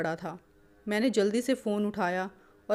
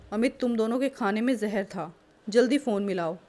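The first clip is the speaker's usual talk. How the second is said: ordinary delivery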